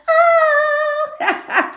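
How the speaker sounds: very high sung ad-lib cry; high falsetto whoop. Pretend. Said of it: "Remember you sang that?".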